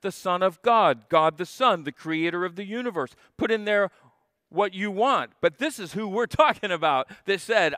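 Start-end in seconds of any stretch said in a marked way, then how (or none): none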